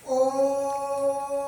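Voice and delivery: ओम sung long over three beats; drawn-out om. Shown as a demonstration. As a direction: chant ओम slowly and long. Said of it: "ओम का उच्चारण करते हैं". (ओम को लंबा गाकर)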